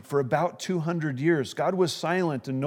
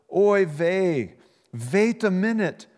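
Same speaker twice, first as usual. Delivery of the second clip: put-on Yiddish accent; 6 semitones higher, 6 dB louder, slower than usual. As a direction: exclaim it like an old Yiddish grandpa.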